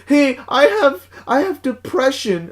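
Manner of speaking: high mock caller; prank caller voice. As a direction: whine it like a teasing prank caller.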